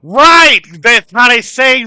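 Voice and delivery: loud, high burst of getting it; excited realization. Real, not acted. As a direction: blurt it out as the joke clicks. Suddenly gets the British joke.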